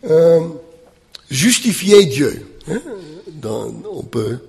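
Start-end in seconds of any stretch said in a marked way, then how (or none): none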